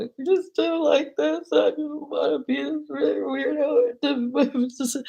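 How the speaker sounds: high, drawn-out tearful wail; mock crying. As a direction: imitate your drunk self bawling to a cousin. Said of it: "Maybe I needed a good cry".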